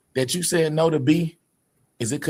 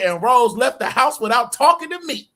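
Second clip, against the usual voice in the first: mock Wolfie; 6 semitones higher, 3 dB louder than usual. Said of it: "that's not even how I talk".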